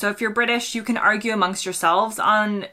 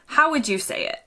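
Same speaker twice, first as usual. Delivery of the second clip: ordinary delivery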